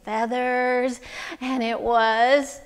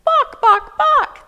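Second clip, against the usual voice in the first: high, loud imitated chicken squawks; chicken clucking; 14 semitones higher, 10 dB louder, slower than usual. Storyteller showing children what chickens say, squawking. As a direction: squawk it like a hen. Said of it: "what were the chickens saying".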